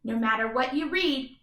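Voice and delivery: plain reading voice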